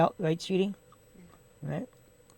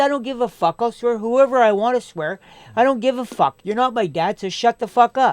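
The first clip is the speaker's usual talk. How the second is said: higher, louder, defiant swearing; heated retold comeback; 6 semitones higher, 5 dB louder than usual. Supervisor telling off the co-worker over swearing, defiant retold anger. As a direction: fire back as if reliving the row.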